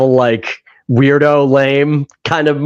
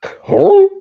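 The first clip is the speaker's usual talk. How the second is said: confused huh. Retold or imitated. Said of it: "his response is just".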